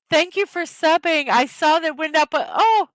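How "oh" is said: sudden high loud oh; surprised gasp. Real, not acted. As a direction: caught off guard with joy. Notices the sub count rise.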